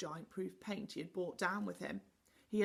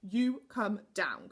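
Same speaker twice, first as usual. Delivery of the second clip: slow, louder command; 8 dB louder, slower than usual. A narrator's reading. Moonface orders Connie down the ladder, slow and firm. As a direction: order her down firmly. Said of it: "commanded Moonface".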